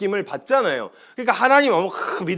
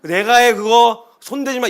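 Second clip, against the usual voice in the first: firm protective command; 3 semitones higher, 6 dB louder, slower than usual. Acted. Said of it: "이렇게 하면 든든하단".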